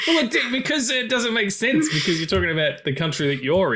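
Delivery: laughing. Real, not acted. Laughing through his explanation.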